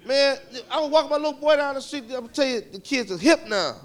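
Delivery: usual speaking voice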